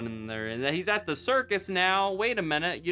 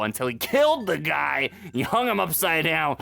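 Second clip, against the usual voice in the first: ordinary talk, nothing marked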